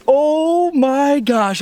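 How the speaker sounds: long, high, drawn-out exclamation; amazed. Real, not acted. Angler reacts to the ten pound fish, amazed. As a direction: stretch each word in disbelief. Disbelief at the weight.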